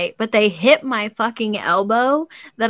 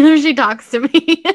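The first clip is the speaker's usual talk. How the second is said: threat breaking into laughter, louder; laughing through a threat; 6 semitones higher, 8 dB louder than usual. Retold or imitated. Retelling her furious threat to the husband, laughing loudly.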